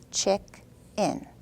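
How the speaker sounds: chick and in split with a pause; chick-in in two slow parts; syllables said apart. Shown as a demonstration. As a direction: say each part as you move the chip. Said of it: "say that syllable and move the chip".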